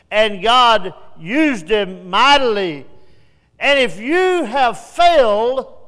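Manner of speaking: loud, slow, rising preaching; a preacher's closing altar-call climax; preaching crescendo. Real, not acted. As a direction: build to a shout on trust God.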